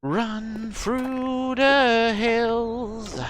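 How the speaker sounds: very slow, high, drawn-out hammy call; dramatic drawn-out cry. Pretend. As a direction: stretch it like a movie panic cry.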